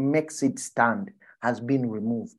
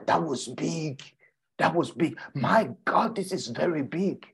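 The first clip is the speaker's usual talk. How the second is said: lightly acted amazement, pitched up a little; mild mock awe; 3 semitones higher than usual. Disciple amazed at what seemed big, mild awe. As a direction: marvel like an impressed disciple. Peter's awe at the withered tree.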